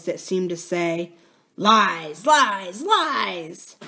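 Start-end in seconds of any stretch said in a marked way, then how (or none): 1.5-3.8 drawn-out goblin taunt; hoarse indistinct voice. A narrator's reading. Unseen creature tittering from somewhere near, hoarse taunt. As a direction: chant it hoarsely, stretching each word. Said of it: "a hoarse, indistinct voice".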